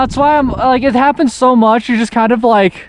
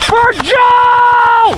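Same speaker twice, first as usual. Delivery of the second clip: battle cry; 13 semitones higher than usual. Acted. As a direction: charge in shouting it. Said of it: "my P three battle cry now for Joe".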